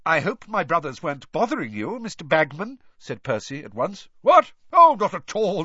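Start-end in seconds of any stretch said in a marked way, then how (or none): 0.0-2.8 put-on officious young man. Official fawning over a senior. 4.2-5.6 high, loud, breezy surprise; startled jovial reply. Acted. Startled, jovial official answers.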